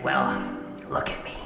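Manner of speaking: drops very low and soft; deflated sigh. Acted. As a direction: sag into self-pity. Robot laments its broken state.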